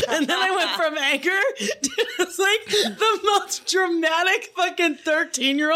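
high, squeaky laugh-talk. Real, not acted. Telling her own meltdown, cracking up, pitch way up. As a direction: barely get it out through laughter.

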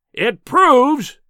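sudden loud, high roar; roaring fury. A narrator's reading. Professor exposing the impostor, roaring with sudden fury. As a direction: explode into a furious roar. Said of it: "he roared".

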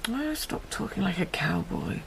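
hushed whisper so as not to wake anyone. Acted. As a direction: keep it to an urgent whisper. Wife whispers at night in bed.

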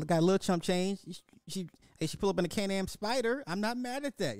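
high, hyped-up approval; excited. Real, not acted. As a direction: sound impressed and amused. Reactor impressed by the bike.

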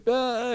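high, soft mock wail; imitated baby cry. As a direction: imitate a weak little newborn crying. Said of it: "you know, crying".

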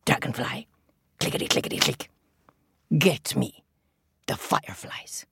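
low, stern king; crab king voice. Acted. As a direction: scold like a grumpy king. Crab king clicks orders.

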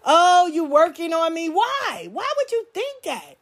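rising mock outrage; mock incredulous accuser.

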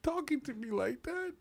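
high, soft, halting protest; mock restrained indignation. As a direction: swallow the rage and answer very politely. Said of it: "I'd be like".

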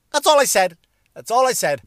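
high, insistent plea of innocence; defensive protest. Acted. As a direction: protest it with the pitch shooting up. Protesting innocence after the punchline.

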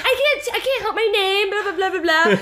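high, fast whiny mom impression. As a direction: whine like a fussy mother. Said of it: "the mom's like".

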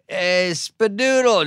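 put-on high, slow, sloppy drunk hello; drunk fan greeting. Drunk fans greet the comedian by name, slurred and high. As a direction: ham up a wasted fan calling out.

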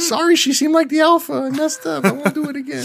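high squeaky alien apology. Pretend. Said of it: "my alien voice".

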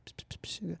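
quick voiceless "psst" whispers; hushed hissing whisper noises; imitated whispering. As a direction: mimic someone whispering in her ear. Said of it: "someone goes".

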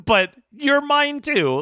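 high and eager, slowed; high eager reply. Retold or imitated. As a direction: gush it back eagerly. Acted reply to friend claim.

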